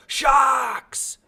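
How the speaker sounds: high, loud terrified yell; frightened roar. A narrator's reading. Timothy warns the drowning boy of sharks, frightened roar. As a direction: roar a warning in fear. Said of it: "Timothy's frightened roar".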